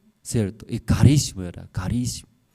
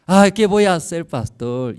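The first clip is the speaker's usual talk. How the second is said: imitating a desperate lament, pitched up; a worried brother crying out to his pastor; despairing plea; 6 semitones higher, 4 dB louder than usual. (lamento desesperado imitado)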